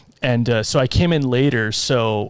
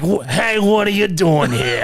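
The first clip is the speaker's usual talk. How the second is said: higher-pitched put-on character voice; mimicking John; 5 semitones higher than usual. Scare actor coming up to a couple at dinner, mimicked character voice. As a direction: imitate a pushy character accosting someone. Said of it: "I'm trying to mimic his voice".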